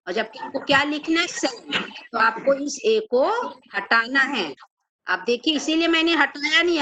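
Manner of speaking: normal talking voice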